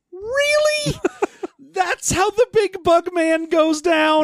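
very high disbelieving squeak, then a laugh; incredulous. Retold or imitated. Viewer reacting to the Bugman's defeat, high incredulous disbelief. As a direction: sound utterly unimpressed and amazed. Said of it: "And you're like".

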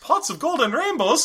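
high, slow mock Irish; hammed-up Irish accent; leprechaun impression. Joining in the leprechaun bit.

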